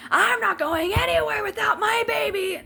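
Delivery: high, wailing shouted protest; frantic refusal. Retold or imitated. Retold frantic refusal to staff.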